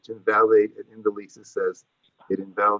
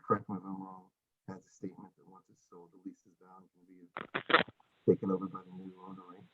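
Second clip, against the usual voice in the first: much softer, muffled delivery; barely audible; 11 dB quieter than usual. Real, not acted. Landlord explaining the lease to the hearing, barely audible. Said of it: "Can't hear you. Can you uncover your microphone".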